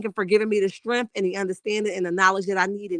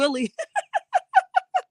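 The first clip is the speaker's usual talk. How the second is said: a shrieking burst of laughter; high squealing laugh. Real, not acted. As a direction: squeal with delight. Laughs at the chat's joke.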